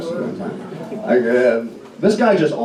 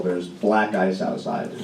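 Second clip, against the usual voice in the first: quiet warning; 6 semitones lower, 6 dB quieter, slower than usual. Retold or imitated. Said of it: "he goes".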